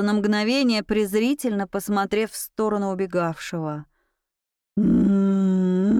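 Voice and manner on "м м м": long droning low growl; werewolf roar. A narrator's reading. Werewolf setting off after the tutor, roaring. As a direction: hum out a sustained beast growl. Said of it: "заревел оборотень".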